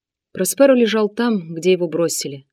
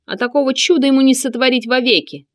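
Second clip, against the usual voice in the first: higher scornful eunuch; scoff, raised and boastful; 6 semitones higher, 4 dB louder than usual. A narrator's reading. Sinan continues.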